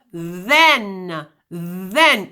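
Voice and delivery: "then" with a voiced th, throat buzzing. Shown as a demonstration. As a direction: let the th in "then" vibrate. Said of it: "if you say the word the".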